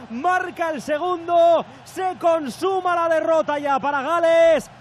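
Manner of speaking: slow, emphatic goal recap; excited commentary. Acted. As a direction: savour the goal, slowly.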